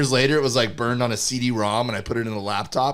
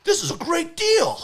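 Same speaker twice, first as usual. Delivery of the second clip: excited. Retold or imitated. Retold excited self, huge pitch jump.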